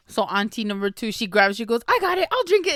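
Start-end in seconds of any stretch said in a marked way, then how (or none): 1.9-2.7 high, loud, eager interjection; hurried volunteering. Retold or imitated. Auntie grabbing the laced champagne, eagerly cutting in. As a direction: jump in quickly, pitched right up.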